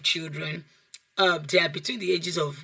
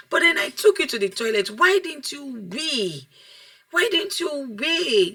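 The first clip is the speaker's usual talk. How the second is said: mock exasperated parent; 8 semitones higher, 4 dB louder than usual. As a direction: drag it out like a fed-up mum. Parent scolding child, mock.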